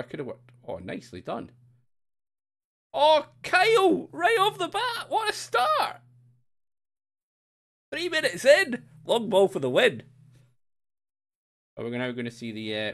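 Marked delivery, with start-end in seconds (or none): 2.9-6.0 high, loud football commentator shout; hammed-up excited goal commentary; mock commentator call. 7.9-10.0 loud, high-pitched goal commentary; excited commentary. Pretend. Commentator call continues.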